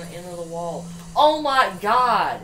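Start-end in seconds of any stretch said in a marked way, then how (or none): 1.2-2.4 shocked outcry. Real, not acted. Near crash.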